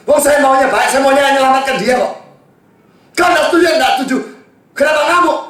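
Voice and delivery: preaching at full force. Acted. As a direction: hammer it home to the congregation. Preacher rails at angry listeners.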